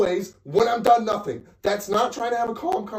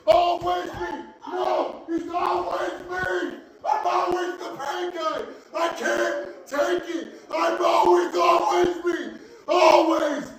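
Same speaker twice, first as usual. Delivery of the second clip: higher, hoarse frustrated shouting; screaming; 4 semitones higher than usual. Real, not acted. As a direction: yell it out, worn down and fed up. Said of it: "why are you screaming at me?".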